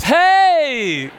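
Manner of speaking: hammed-up, very loud and high hey, hey with a laugh; overdone cheerful greeting. Preacher copying the coworker around the office, hammed-up loud hellos. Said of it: "I'm gonna do that".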